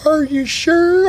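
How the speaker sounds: very high, slow doubtful squeak; Sugar Tits voice. Acted. Sugar Tits doubting the narrator, high slow squeak. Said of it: "He's like".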